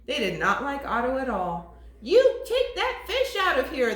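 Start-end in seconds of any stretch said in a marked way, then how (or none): 2.0-3.9 mock yell. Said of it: "they yelled".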